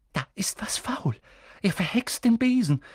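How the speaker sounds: hushed start rising high; urgent half-whisper climbing in pitch; urgent revelation. Acted. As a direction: breathe it, then raise the alarm. Girl urgently reveals the jinx.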